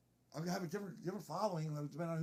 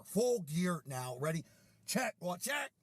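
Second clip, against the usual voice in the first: mock drill leader; 5 dB louder, much slower than usual. Leader readies the team in full gear, loud mock command. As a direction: call out the checks like a drill.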